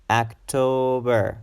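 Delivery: October drawn out in three slow syllables; slow "October", stretching the middle TO. Shown as a demonstration. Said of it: "It's a three-syllable".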